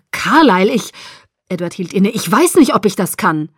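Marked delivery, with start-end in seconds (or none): 0.1-0.9 loud appeal, breaking off; distressed protest. A narrator's reading. Edward breaks off, afraid he cannot. 2.1-3.5 distressed doubt. A narrator's reading. Edward doubts he can do it.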